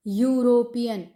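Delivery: ordinary talk, nothing marked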